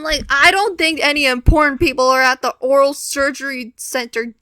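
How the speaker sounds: ordinary talk, nothing marked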